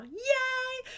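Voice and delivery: high bright cheer; happy yay. Real, not acted. Host cheering viewers off to make scarves, delighted. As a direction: toss out a joyful yay.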